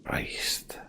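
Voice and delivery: breathless whispered Christ under the breath; a stunned whisper to himself; awed whisper. Real, not acted. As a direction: let it out as a hushed whisper. Awed after finishing the saga.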